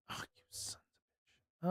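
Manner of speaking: muttered under his breath, voiceless; a real whisper of dismay at the clock; whispered curse. Real, not acted. Reacts to the late hour.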